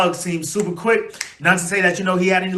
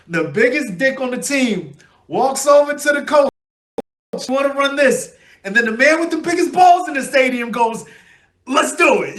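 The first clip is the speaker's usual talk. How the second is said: higher and louder, a fired-up quote; hyped shout; 8 semitones higher, 5 dB louder than usual. Retold or imitated. Fan on his vlog retelling Kelce's speech, hyped shout. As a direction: shout it like a pumped-up crowd.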